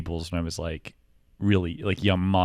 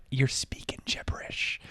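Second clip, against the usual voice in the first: breathy whisper under the breath; whispered aside; 4 semitones higher, 6 dB quieter than usual. Retold or imitated. Waiter whispering about a customer's gibberish order. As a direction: mutter it to yourself unheard. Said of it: "And I'm like".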